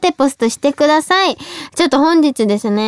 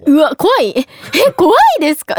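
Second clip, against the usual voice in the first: 驚いたスタッフ; 4 semitones higher than usual. Retold or imitated. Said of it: "めちゃくちゃ驚かれて".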